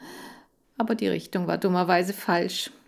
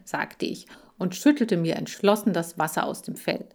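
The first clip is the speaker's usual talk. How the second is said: ordinary delivery, nothing marked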